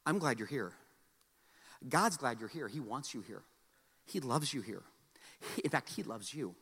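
soft tender pastor's voice; tender reassurance. Acted. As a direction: speak gently to the hurting. Tender sermon passage.

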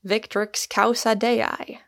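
ordinary delivery

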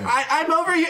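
very high, urgent call. Acted. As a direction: squeak it out, close to yelling. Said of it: "if you can't find her".

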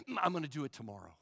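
quick, low, lightly acted mumble; backing down. Person putting off an apology, sheepish backing down. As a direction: hurriedly put it off under your breath.

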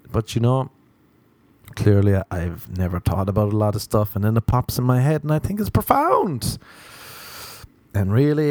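ordinary talk, nothing marked

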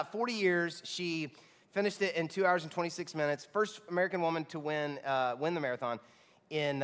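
normal talking voice

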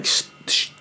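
retold unvoiced shush; soft shush to quiet a sleeper; imitated hush, barely voiced. Waking a snorer, shushing him.

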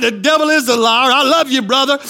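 ordinary talk

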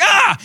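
high loud mock scream of fright; startled scream. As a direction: shriek as if jumped by a ghost. Disciples terrified by Jesus.

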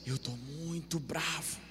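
soft voice. Shown as a demonstration. Interpreter echoes the hushed angry line, softly. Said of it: "E fala assim".

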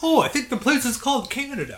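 high mock northern accent on "Canada"; imitating a Heritage Minute. Retelling a Heritage Minute to the cohost in its accent. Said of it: "They just all have, like, accents".

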